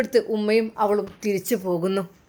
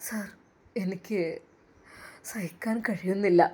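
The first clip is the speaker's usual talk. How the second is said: heartbroken; 4 semitones lower, 6 dB quieter than usual. A narrator's reading. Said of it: "വല്ലാതെ ഇടനെഞ്ച് പൊട്ടിപ്പോവുകയാണ്".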